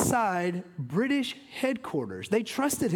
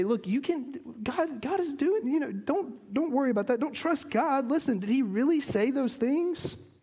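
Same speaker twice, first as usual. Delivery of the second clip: higher coaxing tempter voice, lightly hammed; manipulative serpent; 6 semitones higher than usual. Serpent coaxing the woman to distrust God, higher wheedling. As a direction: wheedle her in a raised, persuasive voice. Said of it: "do you hear the twist and the manipulation".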